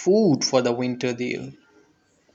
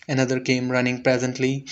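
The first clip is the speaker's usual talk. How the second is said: plain reading voice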